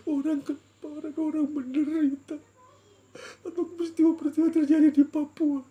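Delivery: loud, high wailing questions; tearful prayer. Retold or imitated. Asking God why He weeps, crying loudly. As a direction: cry each question out to God, swelling at the end. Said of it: "saya berdoa dalam tangisan".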